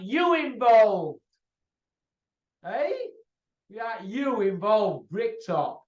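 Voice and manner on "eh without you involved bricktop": ordinary talk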